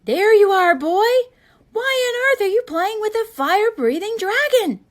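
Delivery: loud, high, scolding yell; a parent yelling at his son; angry shout. A narrator's reading. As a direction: bellow at the boy across the yard. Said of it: "they heard a shout".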